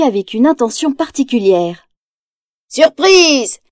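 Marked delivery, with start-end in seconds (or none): 2.7-3.6 higher, mocking cry of surprise; spring the trap with a shout; gleeful shout. Villain's gang ambushing the detective, gleeful shout. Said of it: "crie en chœur la bande".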